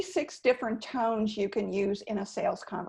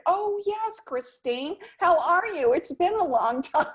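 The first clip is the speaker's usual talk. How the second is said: mock delighted recognition; 7 semitones higher than usual. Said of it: "I've had people say to me".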